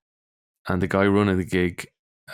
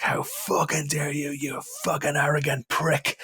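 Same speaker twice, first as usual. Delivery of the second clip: retold family screaming; 7 semitones higher, 3 dB quieter than usual. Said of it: "they were screaming in my face".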